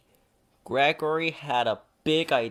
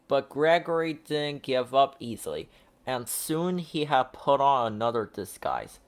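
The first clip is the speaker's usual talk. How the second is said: usual speaking voice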